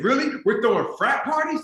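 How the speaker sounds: picture wild frat parties ahead; excited disbelief. Retold or imitated. Student answering the school about the fraternity, eager and excited.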